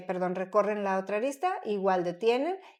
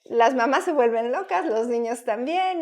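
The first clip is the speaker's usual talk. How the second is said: louder, amused and laughing; 9 dB louder, slower than usual. Real, not acted. Host laughing with guest about mothers losing it while shopping, loud laugh. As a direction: burst out laughing as you speak.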